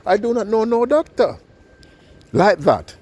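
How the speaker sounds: high, bewildered denial; puzzled old lady. Acted. Lady denying she knows the surgeon, high and puzzled. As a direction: she has never seen this doctor. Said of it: "And the lady said".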